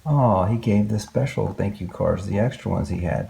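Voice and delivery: ordinary delivery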